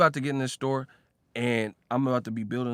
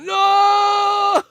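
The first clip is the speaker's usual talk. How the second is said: mock scream; 12 dB louder than usual. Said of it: "They be like".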